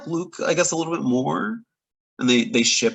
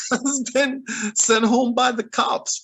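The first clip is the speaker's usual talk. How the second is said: higher voice breaking out of a chuckle; laughing retelling; 10 semitones higher, slower than usual. Retold or imitated. Headmaster reporting a teen sent home by cops, laughingly retold. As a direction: laugh into the line, pitch lifted.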